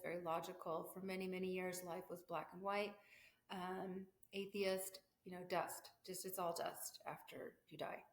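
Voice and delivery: normal talking voice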